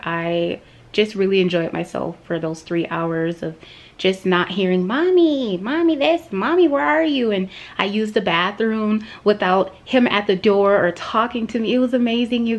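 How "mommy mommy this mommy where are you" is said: high-pitched mimicry of a needy little kid; imitated child calling. Voicing her child's constant calls.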